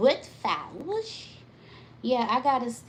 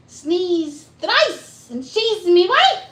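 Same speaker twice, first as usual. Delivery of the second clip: high, loud mock Irish leprechaun lilt; 8 semitones higher, 11 dB louder, much slower than usual. Repeating the rhyme for viewers, in a loud, high mock Irish lilt. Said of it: "It was that leprechaun voice".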